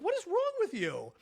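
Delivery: high-pitched incredulous scolding; exasperated disbelief. Retold or imitated. Owner to dog that bit her, exasperated. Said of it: "She literally bit my hand".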